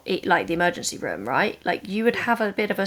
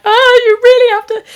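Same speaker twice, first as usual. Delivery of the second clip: much higher, much louder angry cry; angry outburst; 14 dB louder than usual. Retold or imitated. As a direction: let the anger burst out, high and loud. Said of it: "I was so angry".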